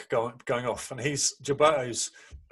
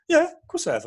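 high casual; 5 dB louder than usual. Retold or imitated. Gilberto's casual answer retold.

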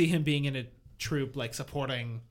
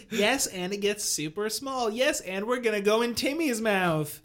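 excited; 7 semitones higher, slower than usual. Acted. Improv bit, escalating teacher voice.

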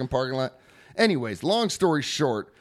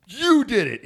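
higher, louder, retold accusation; mock angry accusation; 9 semitones higher, 6 dB louder than usual. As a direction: act out an outraged friend pointing blame. Retold accusation from furious friend.

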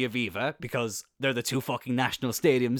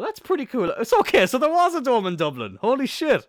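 excited; 5 dB louder than usual. Real, not acted. Discovering the Dome exists.

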